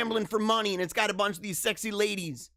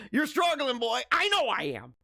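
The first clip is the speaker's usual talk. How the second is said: high hammed-up jeer and comeback; tease, then protest in mock exasperation; mock teasing; 6 semitones higher than usual. Host heckling himself over a hard name, mock teasing. Acted teasing and self-defence.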